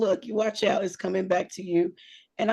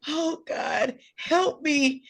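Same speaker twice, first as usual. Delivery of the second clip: high, strained cry for help; mock desperate plea; 6 semitones higher, much slower than usual. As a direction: plead to God as if barely holding on. Acted desperate plea while holding laughter.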